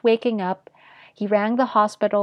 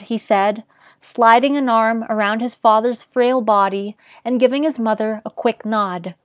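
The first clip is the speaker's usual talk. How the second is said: ordinary delivery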